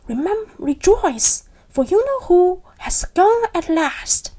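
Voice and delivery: put-on high squeaky old man. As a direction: squeak it out with glee. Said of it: "he said in a squeaky voice".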